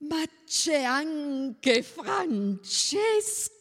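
high, drawn-out gushing exclamation; delighted cry. A narrator's reading. Bona discovers the little girl behind Jeannine, cries out delightedly. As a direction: cry it out slowly with big delight. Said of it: "gridò Bona".

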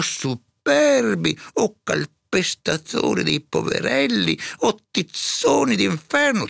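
high, slow, drawn-out denunciation; indignant tirade. A narrator's reading. Serva inveisce contro i prepotenti. (invettiva indignata, sempre più acuta e scandita)

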